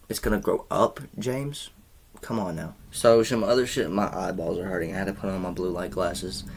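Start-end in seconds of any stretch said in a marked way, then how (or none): none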